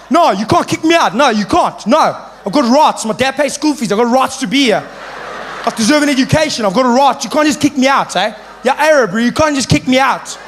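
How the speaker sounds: loud, high-pitched indignant protest; defiant pupil. Acted. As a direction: argue your rights at full volume. Jonathan argues for his rights.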